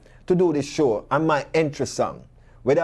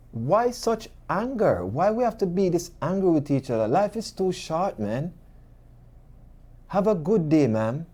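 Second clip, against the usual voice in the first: killing her softly; 4 semitones higher than usual. Retold or imitated. Retelling his answer to an angry elderly lady, soft and gentle. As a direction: plead gently, stretching the words and letting them drop away. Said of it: "This is how me kill her softly".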